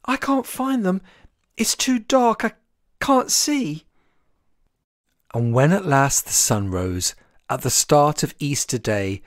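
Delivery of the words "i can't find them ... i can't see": panicked. A narrator's reading. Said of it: "Chick panicked".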